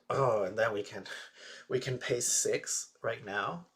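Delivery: loud, eager realization; excited planning. Real, not acted.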